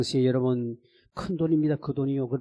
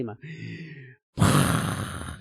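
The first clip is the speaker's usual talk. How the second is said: ordinary talk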